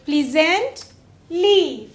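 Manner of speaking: प्लीजेंटली very slowly, pause before the final ली; broken into parts. Shown as a demonstration. Teacher building a word with a suffix, प्लीजेंटली slowly split. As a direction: stretch प्लीजेंटली as "pleasant", then "lee". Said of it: "syllable की तरह काम करता".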